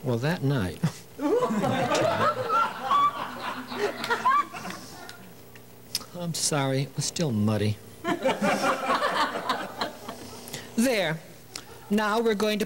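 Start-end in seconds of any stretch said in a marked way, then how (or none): 1.2-5.1 long, rising peal of laughter; prolonged high laughter. Real, not acted. Laughs at own joke. 8.0-10.7 long, rising peal of laughter; prolonged high laughter. Real, not acted. Still laughing.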